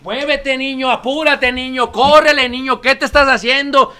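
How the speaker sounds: a mock exasperated parent shouting; impatient parent yelling.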